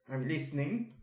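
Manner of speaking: plain reading voice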